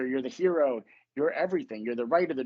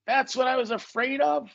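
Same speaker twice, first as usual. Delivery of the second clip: high-pitched cartoon-character amazement; cartoon reaction; 7 semitones higher than usual. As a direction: put on a higher cartoon voice. Cartoon Bugs Bunny's startled reaction.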